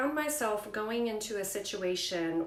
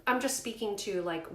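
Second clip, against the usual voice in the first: ordinary talk, nothing marked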